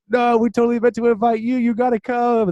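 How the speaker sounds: high-pitched, eager buddy imitation; excited insisting. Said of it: "All these exclamation marks".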